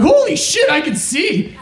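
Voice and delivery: high, squealing amazed cry; excited exclaim. Acted. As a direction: gasp it out, astonished. Joke character exclaims.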